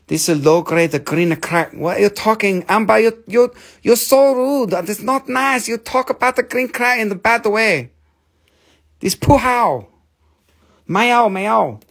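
high, indignant mock Chinese accent. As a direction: scold in a hammed-up Chinese accent. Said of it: "I also go to China".

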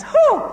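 high, loud answering whoop; answering hoo call. Retold or imitated. Other half of the crowd answering, high loud whoop. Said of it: "The other half says".

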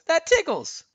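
high, loud ticklish squeal; high giggly cartoon voice; Olaf giggling. A narrator's reading. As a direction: exclaim high, as if being tickled. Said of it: "Olaf giggled".

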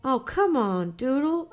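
coaxing, pushing brother on; urging. A narrator's reading. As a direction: urge him on, coaxing. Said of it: "I urged".

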